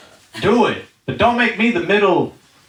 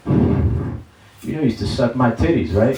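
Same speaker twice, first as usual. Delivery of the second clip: mom smoking, stoned. Retold or imitated.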